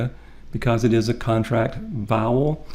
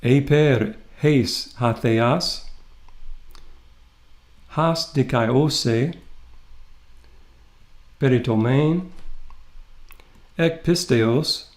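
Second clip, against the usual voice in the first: very slow, spaced-out Greek reading; read-along Greek recitation; much slower than usual. Shown as a demonstration. Teacher asking students to read along, slow recitation. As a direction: lead the class word by word, pausing between phrases. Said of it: "And read with me".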